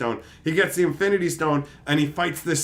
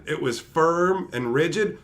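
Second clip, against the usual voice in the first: ordinary delivery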